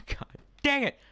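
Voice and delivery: high-pitched yelp of frustration; frustrated outburst. Real, not acted. Gamer killed mid-story, frustrated yelp. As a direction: cry out at a sudden setback.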